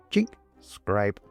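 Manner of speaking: usual speaking voice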